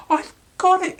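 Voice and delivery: high, loud mouse squeal of discovery; excited squeak. Acted. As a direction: burst out with the idea, high and loud. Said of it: "he said".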